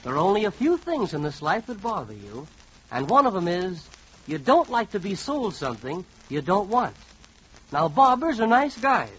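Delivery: ordinary delivery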